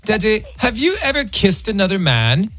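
put-on high, light child voice asking sweetly; a hint of a little daughter at breakfast; innocent little girl. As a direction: ask it like a curious small girl out of the blue. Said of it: "his daughter goes".

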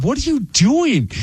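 high-pitched, incredulous question to yourself; mock self-reproach. Retold scolding of himself.

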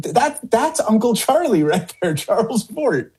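high-pitched quoted grandmother, lightly amused; insistent grandmother. Retold or imitated. Grandmother's insistent reply, acted.

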